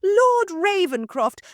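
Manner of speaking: high, loud, slow gushing address; fawning hostess. Acted. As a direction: stretch the lord's name out sweetly. Mother addresses lord.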